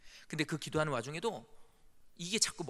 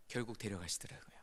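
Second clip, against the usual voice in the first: whisper; 6 semitones lower, 8 dB quieter than usual. Real, not acted. Father's death recounted.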